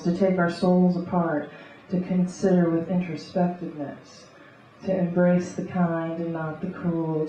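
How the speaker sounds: rhythmic beat poetry reading; beatnik. Acted. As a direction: read it like a coffeehouse beat poet. Spoken word poetry reading.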